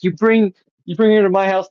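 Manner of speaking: high, loud objection; indignant. Retold or imitated. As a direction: imitate an outraged host confronting someone. Acted argument, cautious man objects.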